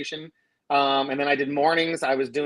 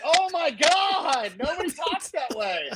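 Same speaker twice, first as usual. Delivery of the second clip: high, drawn-out disbelief; mock exasperation; 11 semitones higher, slower than usual. Reacting to a phony DJ, high exasperated mock wail. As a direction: wail it in comic dismay.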